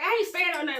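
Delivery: retold denial, a bit extra; over-the-top denial. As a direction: act out an overdone denial. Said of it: "Mark has to be more ... extra".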